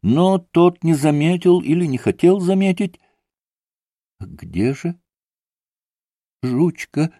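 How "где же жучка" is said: put-on soft, faltering child's question; strained little voice. A narrator's reading. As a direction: ask faintly, with a strained little voice. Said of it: "надорванным голоском спросил Илюша".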